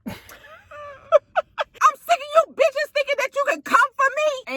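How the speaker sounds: high, drawn-out indignant yell; mock fury in a high voice; mimicked angry outburst. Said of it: "why did [name] lose her accent?".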